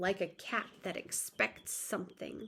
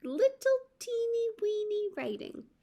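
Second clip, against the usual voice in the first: put-on teeny-weeny voice; 11 semitones higher than usual. A narrator's reading. Narrator shows tiny writing.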